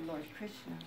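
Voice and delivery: ordinary delivery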